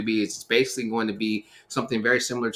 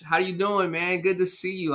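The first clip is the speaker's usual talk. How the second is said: higher, cheerful welcome; excited greeting; 8 semitones higher than usual. Real, not acted. Host welcomes a pastor on the live stream, excited.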